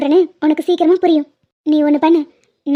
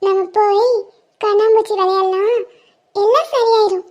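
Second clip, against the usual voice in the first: put-on a tiny girl promising to stay; sweet child reassuring her friend; little girl voice; 3 semitones higher, slower than usual. Plays little girl Percy.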